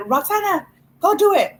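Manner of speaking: high mock-mom command; mother's order. Mother sending daughter to do the chore, imitated order.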